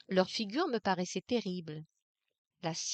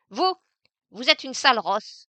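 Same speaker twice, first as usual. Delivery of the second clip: louder, spat-out insult; venomous insult; 4 semitones higher, 8 dB louder than usual. Acted. Maid insulting Aimée on leaving, venomous and loud. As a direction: spit the words at her. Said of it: "Sa face, redevenant venimeuse".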